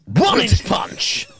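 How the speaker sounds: slow, hammed-up kung fu master; mock kung fu master. Joking with a friend about martial arts, slow mock kung fu voice. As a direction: drag it out like a movie fighter.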